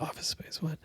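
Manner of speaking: whispered, eager question; perked-up whisper. Retold or imitated. Barista overhearing at the counter, whispered surprise. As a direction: hiss it out, ears pricked. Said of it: "she perks up and she's like".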